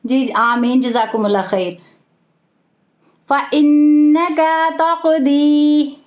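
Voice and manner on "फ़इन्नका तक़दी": slow recitation with held ghunna; recitation demo. Shown as a demonstration. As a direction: draw out फ़इन्नका with a nasal hold on नन्न. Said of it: "यहाँ तक किस किस को याद हो गया". (फ़इन्नका तक़दी धीमी तिलावत, गुन्ना के साथ)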